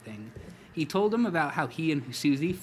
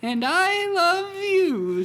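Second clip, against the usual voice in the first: much higher, louder, slow wife voice; high tender wife; 7 dB louder, much slower than usual. Acted. Wife to husband, high, slow and tender. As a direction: answer him high and tender.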